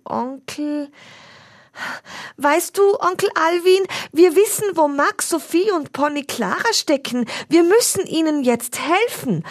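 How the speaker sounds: high, halting ums, then a rush of words; boy explaining to his uncle, flustered; hesitant Finn. A narrator's reading. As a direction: caught out, stall then blurt. Finn stammers an excuse.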